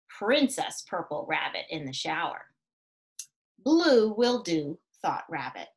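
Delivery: slow, lilting storybook reading; reading to children. A narrator's reading. Reading a rabbit story aloud to young children, gently lilting. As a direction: read it like bedtime story time. Reads picture book aloud.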